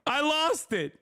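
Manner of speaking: high-pitched, laughing admission; giddy, laughing confession; amused disbelief. Real, not acted. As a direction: say it through laughter, tickled at yourself. Laughing at own televised outburst.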